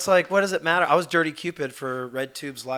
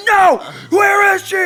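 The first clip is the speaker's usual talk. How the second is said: slow, high, loud shouted demand; mock frantic shout; 16 dB louder, slower than usual. Trolling the scammer, slow frantic shout. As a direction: demand the daughter back. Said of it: "I just started yelling at her".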